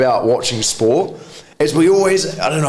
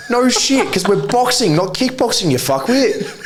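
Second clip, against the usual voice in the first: mock retort; 10 semitones higher than usual. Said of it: "It's like".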